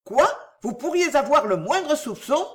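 high, affronted question; astonished and indignant. A narrator's reading. Cécile challenging Delville's suspicion, astonished and indignant. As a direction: ask it high, in indignant disbelief. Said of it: "étonnée et indignée à son tour".

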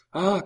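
high, loud yelp of pain; child's cry. A narrator's reading. Royal child hit in the leg, crying out. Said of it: "cria l'enfant".

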